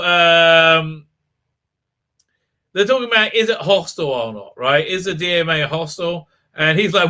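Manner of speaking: ordinary delivery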